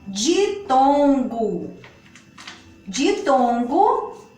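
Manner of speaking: ditongo said slowly, each syllable stretched; slow and drawn out. Shown as a demonstration. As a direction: spell out ditongo slowly for the class. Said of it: "Eles estão divididos em".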